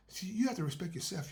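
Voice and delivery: normal talking voice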